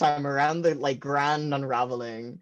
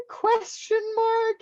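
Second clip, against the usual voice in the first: mock dramatic; slower than usual. Reading boy's wall note.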